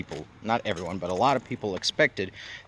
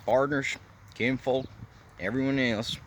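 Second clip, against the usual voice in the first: put-on Cajun accent; 3 semitones higher than usual. Vlogger greets viewers on the bayou in a mild Cajun accent. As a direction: greet the folks like a Louisiana bayou host, mock Cajun accent. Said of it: "try and do this accent".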